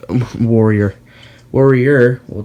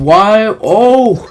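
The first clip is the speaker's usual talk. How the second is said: surprised; 8 semitones higher, 5 dB louder than usual. Real, not acted. Close finish in truck match.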